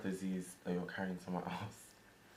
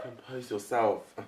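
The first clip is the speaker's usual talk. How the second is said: stern scolding; 3 semitones higher, 7 dB louder, slower than usual. Acted. Son scolds his mother.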